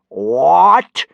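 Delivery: furious high bellow, rising; loud incredulous roar. A narrator's reading. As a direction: bellow it in outraged disbelief. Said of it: "he bellowed".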